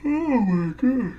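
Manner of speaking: stretched, high yawn through the words. Real, not acted. Sleepy vlogger yawning mid-show after a big lunch. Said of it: "why the frick I'm yawning".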